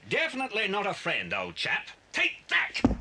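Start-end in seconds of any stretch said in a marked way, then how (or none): 2.1-2.9 high, loud effort as he swings; punching shout. Acted. Bill knocking out the guard, sharp shout. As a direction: throw the punch with the words.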